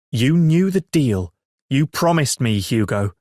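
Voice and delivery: loud, scolding mum; angry mother. Acted. Angry mother confronts son.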